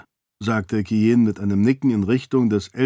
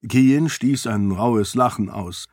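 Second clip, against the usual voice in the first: ordinary delivery, nothing marked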